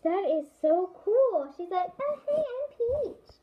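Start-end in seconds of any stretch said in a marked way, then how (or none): 2.0-3.4 high, slow, sweet princess voice; Peach impression. Vlogger voicing the Peach figure for viewers, high and slow. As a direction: play Princess Peach saying hello, pitched way up. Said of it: "That's my best Peach impression".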